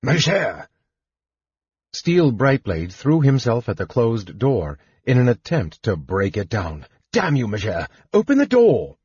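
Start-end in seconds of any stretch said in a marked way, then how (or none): 0.0-0.7 raised, urgent call. A narrator's reading. Knight calls after the mage behind the shut door, calling out. 7.1-9.0 curse, then yell the order; angry demand. A narrator's reading. Knight locked out by the mage, angry demand. Knight furious at closed door.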